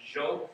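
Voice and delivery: "Jo" with an English J sound at the start; J-sound pronunciation. Shown as a demonstration. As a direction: say "Jo" starting like "Joe", not "Dee-o". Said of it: "actually pronounced with J sound".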